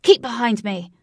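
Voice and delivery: loud protective shout. Acted. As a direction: bark the order to stay back. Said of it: "Wraith shouted to the female form".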